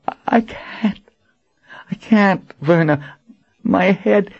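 high, strained voice in pain. Acted. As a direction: plead through a splitting headache. Warren in pain after gift.

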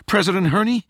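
high, urgent blurt. A narrator's reading. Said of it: "Rachel blurted".

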